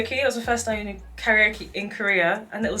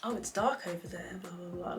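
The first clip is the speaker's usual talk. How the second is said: mock creepy joke; 3 semitones lower, 14 dB quieter than usual. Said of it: "these little slide jokes".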